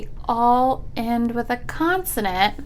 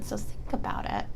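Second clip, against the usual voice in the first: whisper; 3 semitones lower, 14 dB quieter than usual. Acted. Teacher prompting pupils to think.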